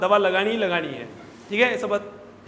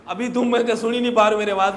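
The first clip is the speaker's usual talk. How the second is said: normal talking voice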